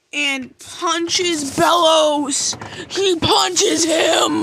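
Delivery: loud, slow, drawn out punches; hyped shout. Real, not acted. Fan hyping the punch to viewers, loud and drawn out. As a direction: yell it like the big moment.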